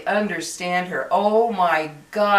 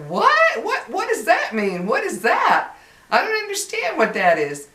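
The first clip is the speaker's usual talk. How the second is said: very high, loud squeals of confusion; utterly baffled; 11 semitones higher, 4 dB louder than usual. Acted. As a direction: act out total incomprehension, pitch leaping way up. Acting out bafflement while researching a fix.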